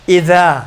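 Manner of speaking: اذا with the tongue tip between the teeth; tongue-tip zaal. Shown as a demonstration. Teacher models zaal for students, thin. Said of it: "نظر آتا ہے نوک زبان".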